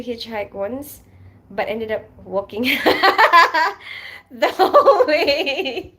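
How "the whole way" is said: breaking up laughing, high and loud; real laughter. Real, not acted. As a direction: laugh through the words, dragging out the end. Laughs at own hitchhiking story.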